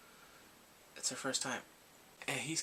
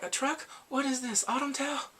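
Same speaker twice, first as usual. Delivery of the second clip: high, raised-voice incredulous questions; indignant disbelief; 11 semitones higher, 4 dB louder than usual. Retold or imitated. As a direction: fire off appalled questions, pitched way up. Indignant at melodramatic twist.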